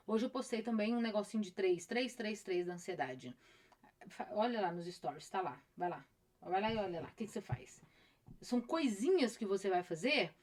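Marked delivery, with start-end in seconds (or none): none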